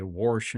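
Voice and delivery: "wash" pronounced "warsh", with an R; slipped-in R. Shown as a demonstration. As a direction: put an R before the "sh" in "wash". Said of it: "I'll slip up and say".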